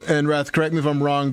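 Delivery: ordinary talk, nothing marked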